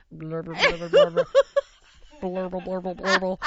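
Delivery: lightly voiced rumbling tummy noise; a playful little mock gurgle; stomach gurgle imitation. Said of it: "her little mouse stomach going".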